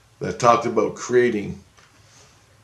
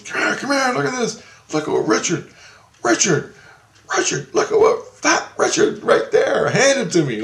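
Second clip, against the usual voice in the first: much higher excited calling with a laugh; flipping out; 13 semitones higher, 3 dB louder than usual. Retold or imitated. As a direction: summon a friend, over-excited. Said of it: "He's just flipping".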